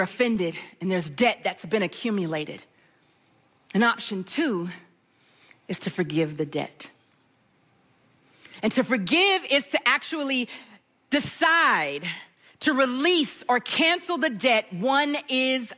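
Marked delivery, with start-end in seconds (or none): none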